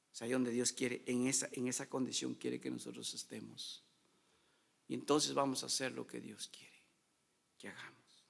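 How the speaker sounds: low, soft, intimate preaching; hushed sermon. Acted. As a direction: draw them in close and gentle. (predicación suave y baja, íntima)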